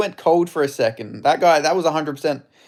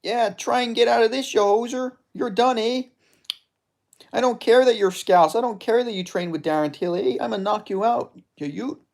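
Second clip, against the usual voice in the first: higher-pitched hammed-up Canadian accent; Albertan hoser; 9 semitones higher than usual. Gamer voicing his fighter's taunts in a high Canadian accent. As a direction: play the fighter as a trash-talking Canadian hoser. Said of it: "I'm doing that voice for this guy".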